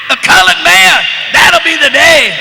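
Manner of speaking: a diner owner jeering at his sweeper; mocking shout. Actor voices Lou mocking Goldie.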